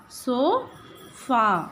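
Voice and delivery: "so" and "fa" said apart with a pause between; syllable by syllable, so, fa; split into syllables. Shown as a demonstration. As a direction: clap out sofa as so then fa.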